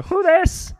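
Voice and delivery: high squeaky question. Retold or imitated. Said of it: "I go".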